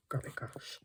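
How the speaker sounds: whispered under the breath; whispered exclamation. Real, not acted. Crafter to herself over stubborn glue, whispered exclamation. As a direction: whisper it to yourself, low. Muttering while struggling with glue.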